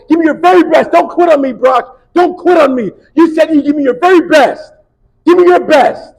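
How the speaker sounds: very loud, high shouted push; coach yelling. Retold or imitated. Coach to Brock, loud high yelling. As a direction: drive him forward with a yell.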